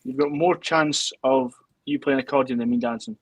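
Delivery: ordinary talk, nothing marked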